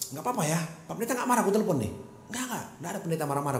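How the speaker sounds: quick, rushed back and forth; fast phone chatter. Retold or imitated. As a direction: rattle it off quickly. Fast phone exchange retold.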